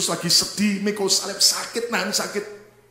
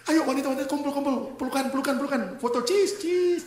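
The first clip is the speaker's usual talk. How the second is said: mock call to pose, higher pitch; playful higher-pitched rallying; eager photo organiser; 7 semitones higher than usual. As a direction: gather everyone for a group photo. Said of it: "Kalau Yesus kayak kita".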